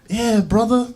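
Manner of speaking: high, loud Aussie "Yeah, brother"; Australian accent. Retold or imitated. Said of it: "with this Australian accent".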